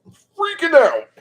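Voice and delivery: very high, loud excited squeal; freaking out. Real, not acted. Crafter about to staple the book, squealing that they're freaking out. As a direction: burst into a giddy squeal.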